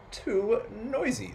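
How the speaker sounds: high, drawn-out grumble; exasperated complaint. A narrator's reading. Old Peter complaining about his house, exasperated. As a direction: stretch both words out in weary protest. Old man fed up with noise.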